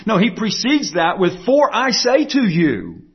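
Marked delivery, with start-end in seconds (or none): 1.5-3.1 "For I say to you" drawn out slowly and pitched high; emphatic. Retold or imitated. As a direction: hammer "For I say to you", high on "For", falling to the end. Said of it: "This is emphatic".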